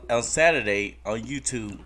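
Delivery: ordinary talk, nothing marked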